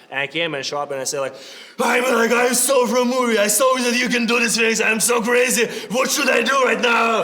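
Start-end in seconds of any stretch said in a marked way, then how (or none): 1.8-7.2 put-on loud, high manic Russian accent; crazy Russian accent. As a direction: play a frantic Russian fan. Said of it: "my crazy, like, Russian accent".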